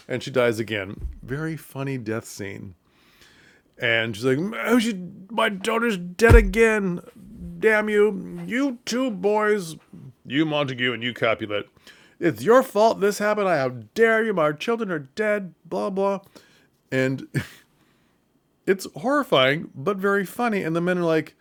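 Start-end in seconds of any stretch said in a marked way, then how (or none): 4.7-16.2 much higher, loud overwrought mother; hammed-up mourning mother; melodramatic grieving mother. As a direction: rail at both fathers theatrically. Mother grieving in retold scene.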